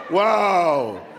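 normal talking voice

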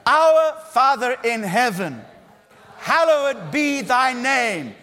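loud, slow, solemn chant of the Lord's Prayer; liturgical recitation. Shown as a demonstration. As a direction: intone it like an Anglican congregation. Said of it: "haven't we got any Anglicans here today?".

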